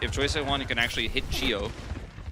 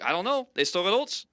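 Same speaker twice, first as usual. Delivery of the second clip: higher, doubtful self-quote; retold hesitation; 9 semitones higher than usual. Caster recalling doubts about the cap, retold hesitation. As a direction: replay your own doubt, pitched up. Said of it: "I said it with hesitation".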